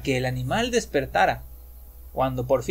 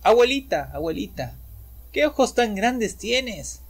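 put-on puzzled girl; 6 semitones higher than usual. A narrator's reading. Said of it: "Extrañada le dijo".

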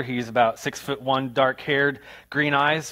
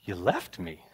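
low, soft and stunned; small voice of a left-behind boy; stunned hurt child; 6 semitones lower, 7 dB quieter, slower than usual. Retold or imitated. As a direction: let the abandoned kid sink in. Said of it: "And I was like".

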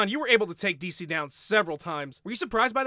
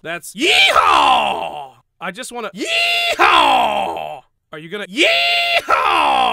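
long, slow, louder whooping yell, climbing higher; drawn-out yeehaw; 4 semitones higher, 9 dB louder, much slower than usual. Acted. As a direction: stretch each yeehaw into a wild holler. Cowboy drawn-out yeehaw yells.

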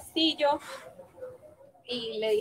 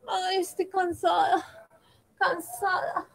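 tired sigh; 5 semitones higher, 4 dB quieter, slower than usual. Acted. Princess exhausted after searching.

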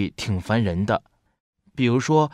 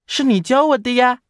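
put-on high, loud little kid voice; eager younger-brother squeak; 12 semitones higher, 7 dB louder than usual. A narrator's reading. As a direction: answer brightly in a small child's high pitch.

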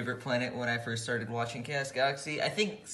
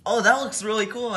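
excited; 10 semitones higher, 8 dB louder than usual. Real, not acted. Unboxing reveal, high and loud.

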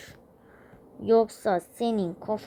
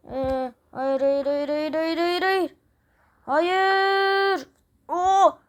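rising, louder, higher panicked cries; frantic rising no no no; 6 semitones higher, 5 dB louder, much slower than usual. Real, not acted. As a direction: escalate the panic as you're about to crash. Player panics about crashing.

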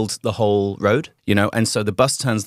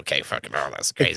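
deadpan shrug; 9 semitones lower, 6 dB quieter than usual. Retold or imitated. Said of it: "You're like".

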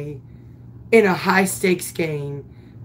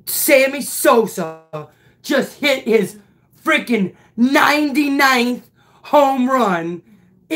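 ordinary delivery

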